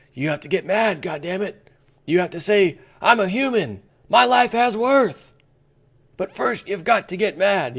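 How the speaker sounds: movie rant quoted, rising to a loud high shout; building rant. Retold or imitated. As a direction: preach it until you're yelling. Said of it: "And the guys tell him".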